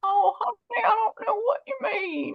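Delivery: high, sweet granny voice; old lady voice. Shown as a demonstration. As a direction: play a frail little old lady. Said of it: "Now I want to hear your old lady voice".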